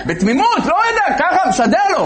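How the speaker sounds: high, wide-eyed innocent voice; naive innocent. Retold or imitated. Storyteller voicing the naive soldier, high and innocent. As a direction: sound naive and clueless, pitched high.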